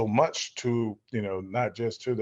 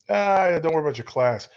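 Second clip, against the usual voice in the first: breezy dismissal; 8 semitones higher, 6 dB louder than usual. Retold or imitated. Agent talking a student out of going to class, breezily dismissive. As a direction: wave the worry away, voice jumping up.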